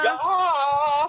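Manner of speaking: long, loud, high German "ja"; mock German accent. Co-host echoes the host in a loud mock German accent. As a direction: belt out a joking German "ja". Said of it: "This is a German podcast".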